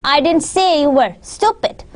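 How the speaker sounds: slight pause, then land hard on "stupid"; stress on STUPID. Shown as a demonstration. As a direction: set "stupid" apart and punch it. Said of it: "the word stupid".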